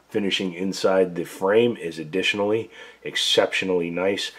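ordinary talk, nothing marked

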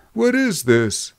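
high, drawn-out puzzled question; mystified. A narrator's reading. Drummer questioning the men in the saloon, mystified. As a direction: ask it slowly, baffled.

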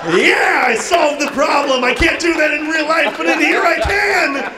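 high-pitched, hammed-up victory cry; mock-triumphant, high and excited; gloating triumph. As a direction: crow over solving the problem. Gloating after muzzling the crying kid.

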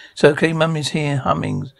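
usual speaking voice

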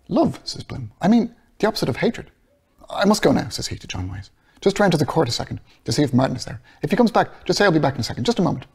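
mean it, then hurry to leave; earnest Bloom. Acted. Bloom voiced higher.

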